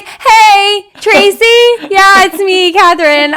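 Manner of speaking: high, loud, whiny phone call; hammed-up upset client calling a therapist; plaintive mock call for help; imitated distressed caller. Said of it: "It's gonna be our therapist".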